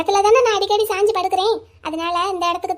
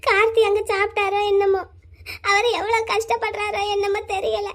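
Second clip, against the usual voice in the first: ordinary talk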